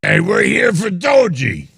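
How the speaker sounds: high-pitched Long Island yelling; Long Island accent. Acted. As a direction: yell it like a hammed-up Long Islander. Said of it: "this is how they talk".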